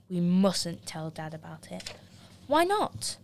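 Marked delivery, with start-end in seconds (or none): 2.5-3.2 high, sharp protest; indignant surprise. Acted. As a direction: shoot up in disbelief. Boy protests secret.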